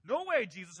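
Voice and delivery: loud, high protest; indignant refusal. Shown as a demonstration. Preacher voices Peter objecting to Jesus, loud and indignant. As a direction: protest forcefully to his master. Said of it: "That's the force in which Peter responds".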